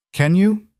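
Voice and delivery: eerily calm challenge, lifting high at the end; calm before something shatters; too calm. A narrator's reading. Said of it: "His voice was calm, too calm".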